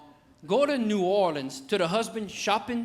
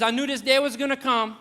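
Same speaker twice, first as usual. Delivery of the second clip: higher, louder retold father-in-law; raised voice, playing the father-in-law; lightly acted father-in-law; 8 semitones higher, 6 dB louder than usual. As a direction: imitate him declaring it loudly. Father-in-law acted facing suitor.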